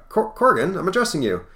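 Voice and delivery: acted, high-pitched insistence; insistent curator. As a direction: raise pitch and volume to get his attention. Curator addresses unresponsive Corrigan.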